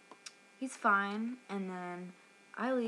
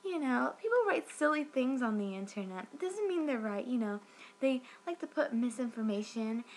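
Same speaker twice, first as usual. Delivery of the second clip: mock dismissive rep, pitched up; Cindy; 3 semitones higher than usual. Customer mimics the rep dismissing online reviews, mock-light higher voice. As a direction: imitate a rep brushing off worries, higher and singsong. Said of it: "And she's like".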